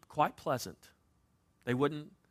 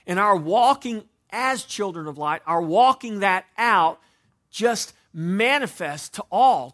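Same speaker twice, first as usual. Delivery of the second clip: raised, louder preaching push; 3 semitones higher, 9 dB louder than usual. Acted. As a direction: preach it out to the congregation. Preacher building to a climax.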